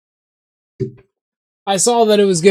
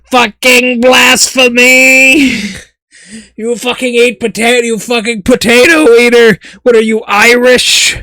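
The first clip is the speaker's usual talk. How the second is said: mock furious rant; 4 semitones higher than usual. Streamer ranting at potato-eating chat, mock fury. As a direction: rant like an outraged zealot.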